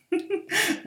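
short high-pitched giggle; high teasing giggle. Acted. Mistress to her pet, a high teasing giggle. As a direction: giggle up high, amused at him.

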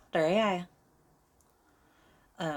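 loud Derry accent. Shown as a demonstration. Showing off how someone from Derry talks, Derry accent. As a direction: lay on a Derry accent, pushing the volume. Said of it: "If I wanna be from Derry".

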